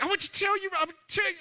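high, loud flustered stammer; mock flustered. A husband trying to explain to his wife, flustered. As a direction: sputter wanting to explain. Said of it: "It's like".

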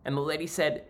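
ordinary talk

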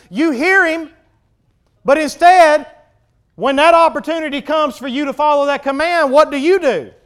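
high, loud challenge from the pulpit; full-force preaching. Real, not acted. Preacher challenges congregation to follow the command, full-force preaching. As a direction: confront the listeners head-on.

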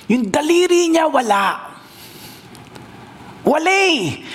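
high, shocked cry at the missing finger; surprised. A narrator's reading. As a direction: gasp it out, stunned. Nakita walang daliri, gulat. (gulat na sigaw)

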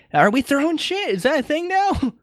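put-on high-pitched old-man complaint; high affronted geezer; indignant old master. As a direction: ask it high, in mock disbelief. Captain's opponent acted, high voice.

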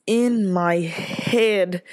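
very slow, stretched "in my head,"; drawn out. Acted. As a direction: drag out each word of "in my head,". Repeated phrase, drawn out.

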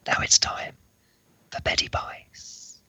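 slow, soft whisper; hushed bedtime close; whispered ending. Acted. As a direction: whisper the last line to sleep.

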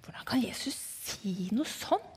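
hushed, whispered murmur of disapproval; indignant whispering. Retold or imitated. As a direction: whisper it like a scandalised onlooker in the crowd. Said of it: "liksom hvisket og tisket og pratet". (forarget hvisking)

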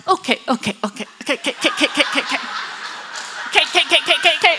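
imitating a flustered dad's pat-pat reassurance; rapid staccato okays, repeated like nervous pats; mock soothing that speeds up into chatter; awkward comforting dad. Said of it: "this is him patting my leg".

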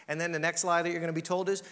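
normal talking voice

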